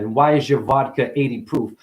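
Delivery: usual speaking voice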